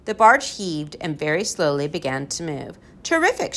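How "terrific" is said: high-pitched delighted cry; a restrained shout of delight. A narrator's reading. Professor delights as the barge moves, shouting. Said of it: "shouted the professor".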